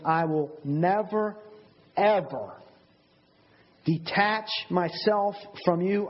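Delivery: "never" and "ever" drawn out slowly, each set apart; slow, deliberate weight on "never, ever"; very emphatic. Acted. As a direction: pause around "ever" to make it land. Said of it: "he's very emphatic. He's saying".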